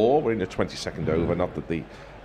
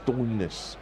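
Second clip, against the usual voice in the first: slow Cockney Stoinis, vowel flattened to stonis; 4 dB quieter than usual. Shown as a demonstration. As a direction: drawl Stoinis like a London gangster. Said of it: "sounds like a kind of Cockney gang".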